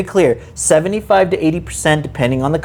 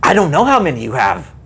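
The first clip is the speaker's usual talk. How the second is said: ordinary talk, nothing marked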